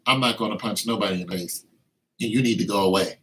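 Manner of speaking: low, soft and quick dismissal; firm quiet refusal. Acted. Believer rebuking the tempting spirit, low and quick. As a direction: brush the voice off fast and low.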